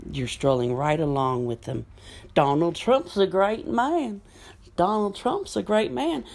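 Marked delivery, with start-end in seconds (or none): 2.3-6.2 higher, sing-song parroting of a fan; mocking Trump fan. Vlogger mocks supporters by parroting their praise in a mock fan voice.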